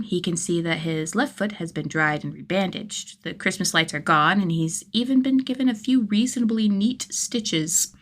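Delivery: usual speaking voice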